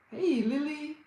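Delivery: ordinary delivery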